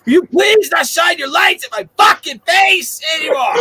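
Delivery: high, slow, shouted demand; shouting at the driver to stop shining the lights, slow and high; megaphone yell. Retold or imitated. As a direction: yell it slowly through a megaphone. Yelling through megaphone at driver.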